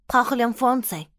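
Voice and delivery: loud stern command; serious scolding. A narrator's reading. Teacher scolding the girl in French, loud and stern. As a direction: scold the class firmly. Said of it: "The teacher scolded seriously".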